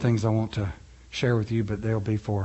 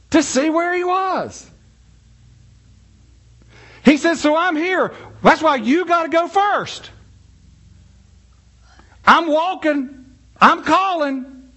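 high, loud, ringing preacher climb; preaching at full force; 5 dB louder than usual. Acted. Pastor to congregation, soaring high full-force preaching.

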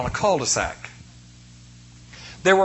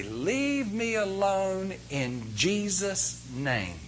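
very slow, softer, deliberate; slow, commanding rebuke; 3 semitones higher, 8 dB quieter, much slower than usual. Retold or imitated. Preacher rebuking a dog in Jesus' name, slow and quiet. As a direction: lay down each word with calm authority. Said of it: "I scream at this dog".

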